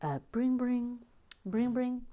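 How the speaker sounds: high sung phone ring, bring bring; playful ring-ring noise. Pretend. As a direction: mimic a telephone ringing. Imitating the Lego phone call.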